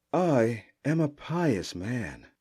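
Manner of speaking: slow, meek pious priest; humble priest. Acted. Amana posing as priest to warrior, slow and meek. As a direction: draw out each word like a humble priest. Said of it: "taking on the persona of a humble priest".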